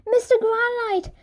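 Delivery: high happy calls; joyful calling. A narrator's reading. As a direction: call out gleefully. Everyone hugging, calling names.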